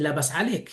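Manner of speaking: "Labas alek" said LA-bas a-LEK, rising on alek; Moroccan Arabic greeting. Shown as a demonstration. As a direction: Moroccan Darija, "Labas alek" with a lift at the end. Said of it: "para decir, "¿Cómo estás?", dicen".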